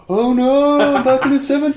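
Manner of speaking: high, loud, hammed-up wail of a stranded cop; mocking the comedy version of the cop; panicked seventies cop. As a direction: wail it like a cop lost in time. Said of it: "a cop back in the seventies being like".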